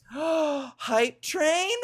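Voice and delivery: thrilled at the news; excited gasp. Real, not acted. Hype train starts.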